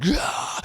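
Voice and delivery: very high rough cry of release; retold wild yell. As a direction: let out an imitated on-stage holler. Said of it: "I was just like".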